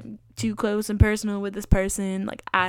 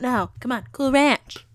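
insistent; 5 semitones higher, 6 dB louder than usual. Real, not acted. Insisting on Cool Ranch.

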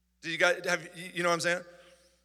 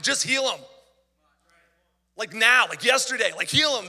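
urgent plea; 2 semitones higher, 5 dB louder than usual. Real, not acted. Urgent prayer for brother-in-law.

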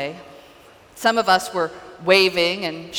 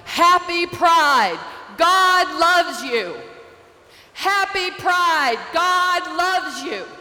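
booming choir voice; 9 semitones higher, slower than usual. Retold or imitated. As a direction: belt it like a choir singer spreading the gospel. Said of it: "I heard a booming voice to my right yell".

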